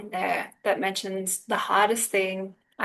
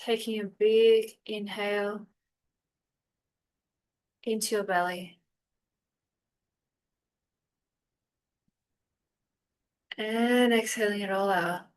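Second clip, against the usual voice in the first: unhurried meditation guide with long pauses; calm, spacious guiding voice; guided meditation; 4 dB quieter than usual. Real, not acted. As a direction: ease them gently through breath and body, phrase by phrase.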